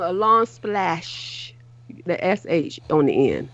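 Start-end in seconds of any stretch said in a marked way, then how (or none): 0.0-1.5 "splash" stretched out very slowly; long splash. Shown as a demonstration. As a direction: draw the word "splash" out long. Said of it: "I said I have a long".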